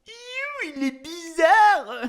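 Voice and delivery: very high, slow disgusted voice; disgusted squeal. Retold or imitated. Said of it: "ceux qui se disent".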